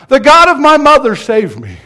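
very high, loud cry that drops at the end; desperate yell. Retold or imitated. Sinner on a sinking ship begging God, desperate yell. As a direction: yell it out as a dying man's plea. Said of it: "what did he yell out?".

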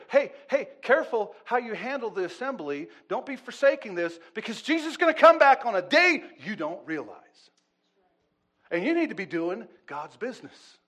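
loud, high preacher's exhortation; urgent warning. Acted. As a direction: cry out a warning to the congregation. Preacher voices scripture's urgent warning.

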